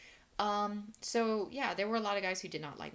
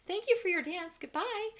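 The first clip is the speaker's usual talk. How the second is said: high, loud, sing-song fake politeness; sugary fake goodbye; 13 semitones higher, 5 dB louder than usual. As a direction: chirp the goodbye far higher and sweeter. Fake polite farewell to customer.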